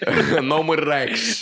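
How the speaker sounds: slow, high hammed-up New York accent. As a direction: drawl it out like a New Yorker. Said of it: "said in a New York accent".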